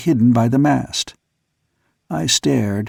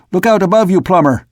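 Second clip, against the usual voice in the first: higher, louder warning cry; near shriek; 6 semitones higher, 4 dB louder than usual. A narrator's reading. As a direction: yell a warning to the man below. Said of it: "I almost shrieked".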